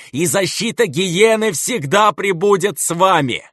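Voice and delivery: high, wild fire-and-brimstone sermon; mad preacher. A narrator's reading. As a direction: preach doom like a fanatic. Безумный проповедник вещает. (безумная проповедь)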